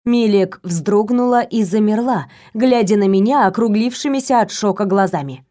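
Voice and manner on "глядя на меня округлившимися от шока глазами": ordinary delivery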